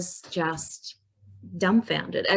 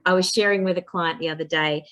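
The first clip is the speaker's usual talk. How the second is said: normal talking voice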